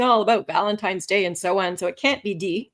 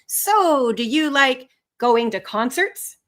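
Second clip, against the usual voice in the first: slow, drawn-out question after a high "So"; tentative, stretched-out invitation to chat; acted conversation opener; 3 semitones higher, 3 dB louder, much slower than usual. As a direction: play Ralph feeling his way into small talk. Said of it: "What if Ralph was to say to Lisa".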